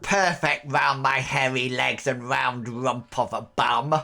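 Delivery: wheedling crackly crone voice; lightly voiced toothless old woman; old hag. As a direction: coax like a cheeky old crone. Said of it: "this toothless hag of a woman".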